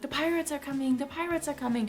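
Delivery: put-on high, urgent call of a boy raising the alarm; kid calling out. Boy shouting to his sick mother, a high hopeful alarm call. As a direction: cry it out like a boy warning the whole village. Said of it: "be like".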